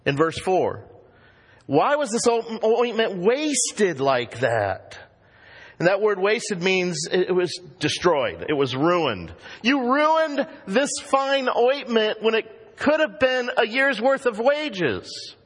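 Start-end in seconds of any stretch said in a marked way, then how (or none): 1.7-5.0 high, slow, outraged question; indignant disciples. Retold or imitated. Preacher voicing indignant disciples over wasted ointment, outraged. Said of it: "what did they say". 9.6-15.3 much higher, slow, outraged rebuke; indignant rebuke. Retold or imitated. Disciples scolding Mary over ruined ointment, outraged. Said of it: "they were indignant".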